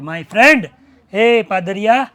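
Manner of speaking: loud, mocking call to the priest; loud sneering hail; loud taunting address. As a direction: call him out with scorn. Thief addressing priest loudly.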